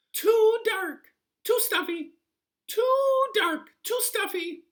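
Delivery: very high, loud squeaky complaint; a fussy little sprite shrieking its gripe; high squeaky goblin whine; tiny creature voice. A narrator's reading. Hudgeon complains about the bed.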